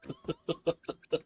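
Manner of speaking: very high squeal of a laugh; squeaky high laugh. Real, not acted. Laughing at own emotional retelling.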